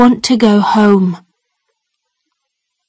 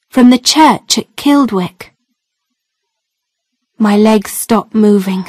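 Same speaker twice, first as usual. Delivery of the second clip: usual speaking voice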